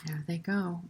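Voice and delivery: soft voiceless whisper; whispered storytime aside. Acted. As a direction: breathe it like a secret.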